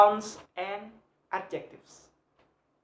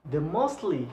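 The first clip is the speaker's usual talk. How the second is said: ordinary talk, nothing marked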